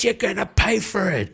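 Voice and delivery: high, strained voice of a furious bandit; enraged bandit. Acted. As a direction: cry out the loss of his mates. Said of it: "He turns and goes".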